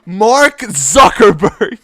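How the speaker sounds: very loud, high, drawn-out shout; Game Grumps catchphrase yell. Retold or imitated. Fan echoing a Game Grumps bit, drawn-out yell. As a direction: bellow the name slowly as a silly imitation. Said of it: "have to say it like that".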